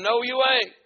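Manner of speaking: loud, high contradiction; shouted refusal. Retold or imitated. Man barring the visitor's way, shouted refusal. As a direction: yell it to block him. Retold quarrel at the gate.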